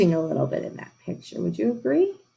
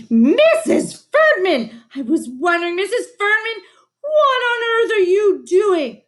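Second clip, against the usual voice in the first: shocked principal; 13 semitones higher, 6 dB louder than usual. A narrator's reading. Principal catching the teacher biting Tina, high and loud. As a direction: burst in appalled at the sight.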